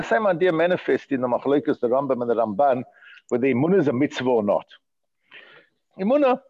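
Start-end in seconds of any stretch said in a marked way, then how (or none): 6.0-6.4 a high, excited burst on the word; exclaimed. Real, not acted. Teacher to class, exclaiming Emunah with fire. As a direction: call the word out with sudden fire.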